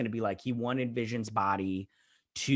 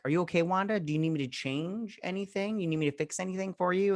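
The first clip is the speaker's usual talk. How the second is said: higher-pitched imitation of a solicitous helper; mock solicitous; 7 semitones higher than usual. Character checks on Wanda, lightly mocked solicitous offer. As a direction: retell an eager offer of help in a raised pitch.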